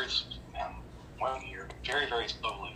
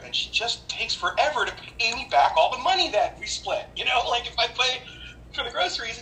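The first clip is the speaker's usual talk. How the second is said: rising anger; 9 semitones higher than usual. Real, not acted. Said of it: "I'm getting angry".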